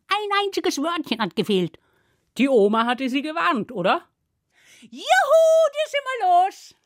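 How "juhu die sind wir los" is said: very high, drawn-out whoop; jubilant cheer. Acted. Children rid of the man-eater, cheering. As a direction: cheer in triumph, voice soaring up.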